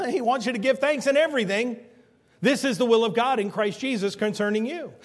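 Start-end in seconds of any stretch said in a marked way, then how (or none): none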